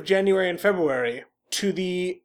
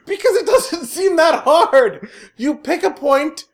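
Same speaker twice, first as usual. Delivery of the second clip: much higher and louder, laughing it out; real disbelief breaking into laughter; exasperated laughing; 8 semitones higher, 5 dB louder than usual. Real, not acted. Exasperated at bad timekeeping.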